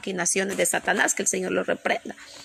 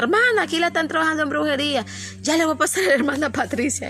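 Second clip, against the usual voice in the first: higher-pitched mock caller passing on news; imitated urgent sister; 7 semitones higher, faster than usual. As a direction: act out a church sister's alert. Voices sisters passing on an urgent case.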